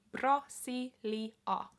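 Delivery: Brasilia drawn out syllable by syllable; very slow, deliberate Brasilia; slow demo. Shown as a demonstration. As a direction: spell Brasilia out slowly for learners. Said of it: "repeating word for learners".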